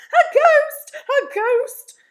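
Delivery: very loud, high-pitched alarm cry; panicked yelling. Acted. Crowd seeing an empty dressing gown, panicked yelling. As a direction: shriek it out in panic. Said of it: "there is a panic with everybody yelling".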